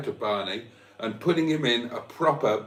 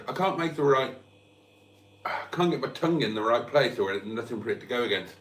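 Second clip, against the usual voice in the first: ordinary delivery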